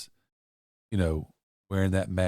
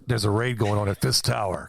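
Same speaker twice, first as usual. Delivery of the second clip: louder dispatch radio call; 5 dB louder than usual. Pretend. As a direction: call it like police radio chatter. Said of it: "in, like, a radio voice".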